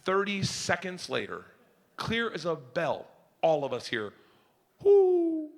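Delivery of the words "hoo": high hoot; a single owl call; distant owl hoot. Retold or imitated. The owl answering from the dark, a high hoot.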